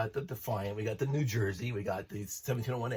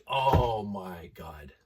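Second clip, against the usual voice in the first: slow, drawn-out, louder oh my God; awestruck; 7 dB louder, much slower than usual. Real, not acted. Fan recalling the Enterprise bridge reveal, awestruck. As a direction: let the awe stretch out.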